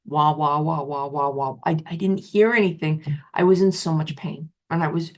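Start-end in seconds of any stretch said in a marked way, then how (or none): 0.1-1.6 lightly voiced muffled trombone "wah wah"; Peanuts adult voices. How everyone around her sounded, a light Peanuts wah-wah. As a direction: mimic the Peanuts grown-ups droning. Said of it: "talking like the Peanuts characters".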